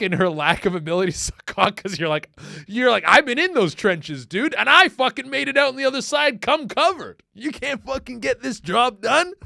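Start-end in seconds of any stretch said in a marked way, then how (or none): 2.7-9.3 loud, high bragging rant; mock yelling. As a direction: boast like a war veteran.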